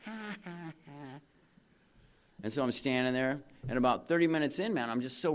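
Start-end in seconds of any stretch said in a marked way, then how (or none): 0.1-1.2 mocking laugh. Imagined crowd laughing at him.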